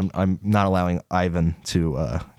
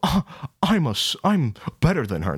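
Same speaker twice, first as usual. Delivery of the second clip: high start, hammed-up self-importance; mock prideful; 7 semitones higher than usual. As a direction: put on a snooty boast, starting high. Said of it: "prideful and all that".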